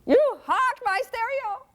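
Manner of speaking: high, slow, stunned squeal; outraged kid. Retold or imitated. Teen finding parent pawned his stereo, shocked wail.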